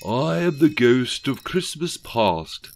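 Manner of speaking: slow, stately voice of a Thatcher-like ghost; ghostly Thatcher. A narrator's reading. The ghost introduces herself to Rishi, slow and grand. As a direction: announce yourself grandly and slowly.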